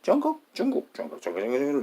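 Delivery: 쩌ngok and 중국 in jumping high pitches; different dialect pronunciations. Shown as a demonstration. Speaker mimicking a hundred different dialects, varied high-pitched pronunciations. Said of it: "in a hundred different ways".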